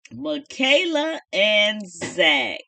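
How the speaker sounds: slow, stretched presentation of the names; slow showman intro; drawn-out announcement. Shown as a demonstration. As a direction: draw out each name like an announcer.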